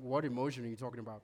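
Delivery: deep, fast, gruff-toned mock macho voice; mock macho man. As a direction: play a dismissive tough guy, rushed and low. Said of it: "Macho men are going".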